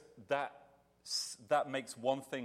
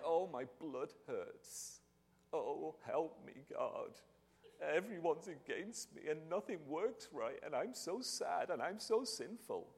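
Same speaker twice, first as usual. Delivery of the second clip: slow, soft, whiny moaning; slow, soft self-pitying mock whimper; mock self-pitying whine; 4 semitones higher, 5 dB quieter, slower than usual. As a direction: ham up a sorry-for-myself complaint. Mocking David's self-pitying lament.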